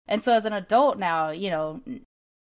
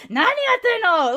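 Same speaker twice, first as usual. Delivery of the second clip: much higher, louder, slow mock scream; screaming grandma; 7 dB louder than usual. Upset grandma yells at strangers in Japanese, mock scream. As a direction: yell it like an upset old grandma. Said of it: "she just starts screaming".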